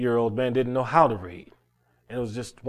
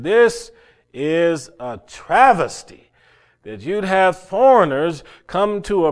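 indignant outburst; 6 semitones higher, 7 dB louder than usual. Retold or imitated. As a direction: hammer home the unfairness, louder and higher to the end. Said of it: "never heard anybody talk like that".

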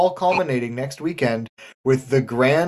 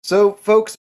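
abrupt wrap-up; 6 semitones higher, 6 dB louder than usual. Acted. Host abruptly wraps up.

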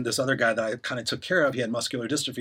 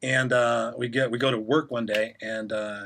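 ordinary talk

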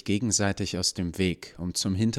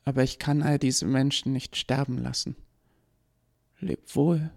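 much higher, gentle sorrowful voice; sad farewell; 8 semitones higher than usual. Acted. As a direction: say goodbye to her maker with sadness. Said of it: "lächelte ihm traurig zu".

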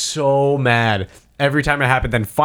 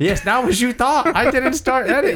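pleading innocence; 11 semitones higher than usual. Retold or imitated. Pleading with fleeing witness.

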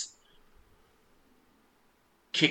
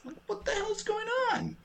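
bewildered; 3 dB quieter than usual. Retold or imitated. Mom reacting to explicit song.

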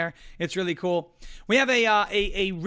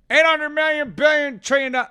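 higher, louder rambling number salad; Joe Biden math; 7 semitones higher, 7 dB louder than usual. Retold or imitated. Host mocks the president fumbling figures, higher and rambling. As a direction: spew numbers like a confused old man. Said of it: "when Joe Biden talks".

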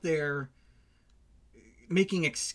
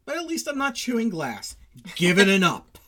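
higher, mocking food critic; 7 semitones higher, 5 dB louder than usual. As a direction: play a smug critic, lifted voice. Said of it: "they'd say".

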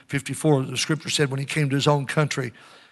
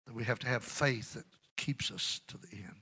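hushed, unvoiced whisper; 5 semitones lower, 16 dB quieter than usual. Preacher whispering to the church about enduring faith. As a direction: draw them in with a secret whisper.

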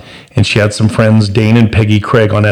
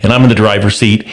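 ordinary delivery

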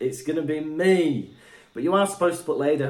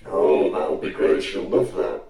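deep voice effect; 9 semitones lower than usual. Shown as a demonstration. Low voice effect on mic.

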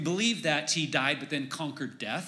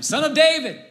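loud, high-pitched imitated cry; calling out; 12 semitones higher, 8 dB louder than usual. Blind man calls out to Jesus, imitated yelling. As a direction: call out as the blind beggar. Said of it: "called out to him".

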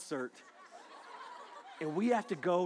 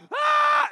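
a high, loud mock shriek of people rushing in; mock scream; 15 dB louder than usual. Preacher mimics crowd running in with a shriek. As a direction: squeal like a crowd running for seats.

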